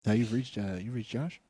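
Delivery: low, soft phone-line worker; hushed, low mock seduction, hesitant; Josh. New operator greeting the caller, low and soft. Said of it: "Josh, be the chick".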